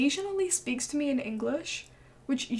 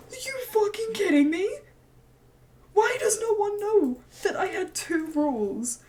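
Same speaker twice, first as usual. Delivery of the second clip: high, incredulous venting; fed-up retelling, pitched way up; exasperated outburst; 8 semitones higher, 4 dB louder than usual. Retold or imitated. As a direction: vent in raised, indignant disbelief. Acted outburst at being asked about work.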